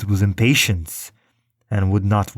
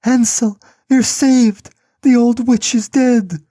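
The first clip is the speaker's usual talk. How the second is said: much higher and louder, overjoyed shout; joyful cry; 6 dB louder than usual. A narrator's reading. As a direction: burst out with the good news. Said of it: "and cried".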